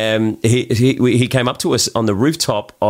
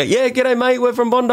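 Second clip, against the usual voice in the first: high, hammed-up Aussie g'day; broad Aussie greeting. Answering the Russian man, hammed-up Australian accent. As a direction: lay the Australian accent on thick. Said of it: "And I go".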